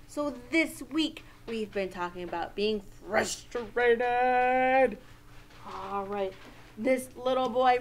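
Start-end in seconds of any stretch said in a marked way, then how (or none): 3.1-5.0 mock-frustrated growl of the word; acted frustration. Teacher introducing the week's feeling to children, hammed-up frustration.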